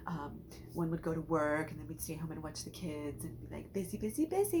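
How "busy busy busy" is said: playing busy mom. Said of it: "we played busy moms".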